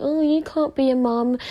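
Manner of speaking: high, condescending mock of the doubters; doubting outsiders. Mimicking people who say a blind woman can't mother, high and condescending. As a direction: sneer it like someone who underestimates her. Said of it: "They think".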